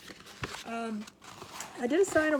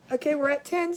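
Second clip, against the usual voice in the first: usual speaking voice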